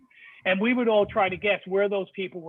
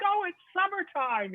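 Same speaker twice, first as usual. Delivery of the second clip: high, slow, sing-song mock of cheerful visitors; mocking carefree tourist; 11 semitones higher, slower than usual. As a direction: drawl it out like a tourist who isn't cold. Mocking northern relatives at beach.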